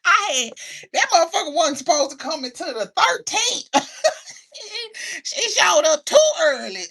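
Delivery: high, incredulous, through laughter; squealed mock outrage; laughing complaint. Real, not acted. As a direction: crack up while griping. Laughing over an early surprise.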